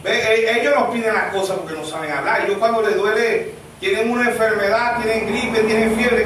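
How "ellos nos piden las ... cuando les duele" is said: ordinary talk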